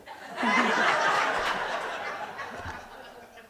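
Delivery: long airy high laugh; cracking up at his own punchline; breathy high laugh after the bipolar joke; real laugh. Real, not acted.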